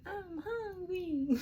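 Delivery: put-on high whining kid calling mommy; whiny child voice. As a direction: whine like a small child. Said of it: "here comes your".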